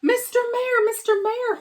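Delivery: high, loud call down to the speck. A narrator's reading. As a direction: call out to someone tiny far away. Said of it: "Horton called".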